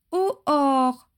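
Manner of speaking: put-on high, piping toddler voice; drawn-out childish cheep; enthusiastic child squeal. A narrator's reading. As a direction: chirp it like an excited little girl. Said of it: "piailla-t-elle avec enthousiasme".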